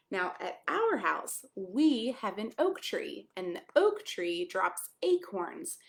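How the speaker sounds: usual speaking voice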